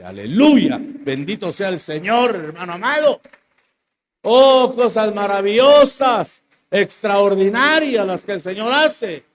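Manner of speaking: loud, high, slow exultation; full-force preaching. Acted. Preacher praising God's wonders to the church, loud and high. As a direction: burst into praise.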